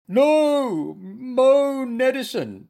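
high, loud, drawn-out protest; squealing. A narrator's reading. Bear refuses his medicine, high loud squeal. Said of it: "squealed Goompa".

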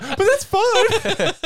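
high, slow squeaky mimic of his line; mocking his voice. Teasing a tall friend, high slow mimicry. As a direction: parrot him back in a silly high voice. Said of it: "But he's like".

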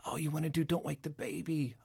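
put-on hushed "don't wake the baby" read. Shown as a demonstration. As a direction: coax it gently, barely above a breath. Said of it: "one of the reads that I always tell people".

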